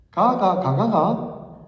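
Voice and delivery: 가가, 가가가 said slowly in Gyeongsang tones; slow, deliberate Gyeongsang riddle; Gyeongsang dialect demo. Shown as a demonstration. Preacher tests the congregation with a Gyeongsang riddle, slow Gyeongsang accent. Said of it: "제가 해볼게요".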